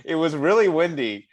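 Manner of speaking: laughing through the words, high and loud; amused, breaking up while reading. Real, not acted. Host rewording Australian slang for the teasing co-host, laughing.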